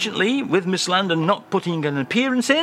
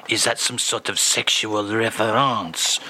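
normal talking voice

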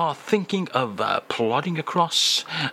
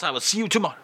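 usual speaking voice

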